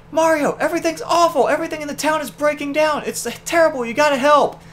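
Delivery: high, panicked little Toad voice; frantic high Toad pleading for help; distraught Toad. Retold or imitated. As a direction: wail the bad news like a frowning Toad. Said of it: "second distraught Toad with a frown".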